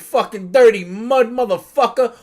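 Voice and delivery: lightly voiced tough New York Italian; Italian New Yorker. Friend voicing Frieza as an old Italian New Yorker, mildly acted. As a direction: sneer it like an old Brooklyn guy. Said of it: "old Italian racist in New York".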